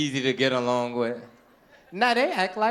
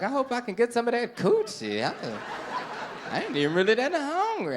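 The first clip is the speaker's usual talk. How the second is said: higher, pleading inner thoughts; eager inner voice; 8 semitones higher than usual. Acted. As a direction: beg silently for her. Man's inner voice, lustful.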